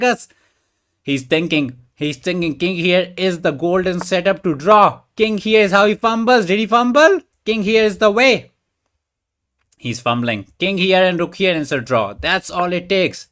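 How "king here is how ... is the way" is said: louder, higher, keyed-up calls over the board; excited. Real, not acted. Streamer watching the opponent's king move, excited and loud. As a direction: call the moves out as the tension peaks. Tense endgame, opponent may fumble.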